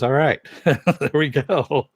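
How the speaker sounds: laughing through a drawn-out quote; amused reaction. Retold or imitated. Bowler's date reacting to her perfect strike, laughing. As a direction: chuckle as you play the impressed onlooker.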